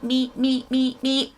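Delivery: high beeping imitation; imitated car beeps. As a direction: beep like a dashboard warning.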